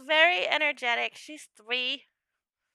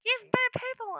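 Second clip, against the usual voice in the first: much higher, eager gush; gushing people-lover; 9 semitones higher, 3 dB louder than usual. Retold or imitated. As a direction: gush like you adore everyone. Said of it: "And then I'm also like".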